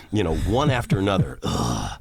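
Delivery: usual speaking voice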